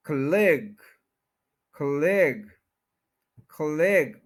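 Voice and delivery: खलेग as kuh-LEG, falling; tiny "kuh", weight on LEG in खलेग; stress on LIG. Shown as a demonstration. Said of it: "लिग के ऊपर पूरा stress है".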